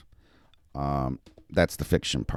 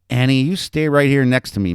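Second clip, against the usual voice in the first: raised, higher scolding call; grandmother's yell; 7 semitones higher, 6 dB louder than usual. A narrator's reading. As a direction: call the child to heel, loudly. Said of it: "Missus Hamburg yelled".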